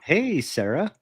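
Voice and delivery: drawn-out put-on greeting; imitated video caller. Victim greeting the girl on video, mock smitten. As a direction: play a smitten caller saying hello. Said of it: "let's hop on a video call".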